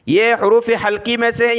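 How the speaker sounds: ordinary talk, nothing marked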